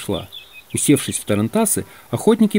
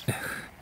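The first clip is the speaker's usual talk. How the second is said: soft, breath-heavy sigh; weary sigh; 17 dB quieter than usual. A narrator's reading. Doctor missing his patients, sighing. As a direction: sigh quietly with regret. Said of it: "Доктор вздохнул".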